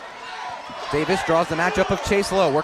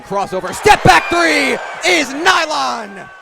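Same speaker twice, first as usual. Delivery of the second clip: high, loud rising call that keeps ringing after the shot; excited call; 6 semitones higher, 3 dB louder than usual. Real, not acted. Play-by-play man calls the tying three, thrilled. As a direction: erupt as the three drops, then keep the energy high.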